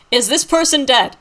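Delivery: quizzing like a test examiner; mock test question.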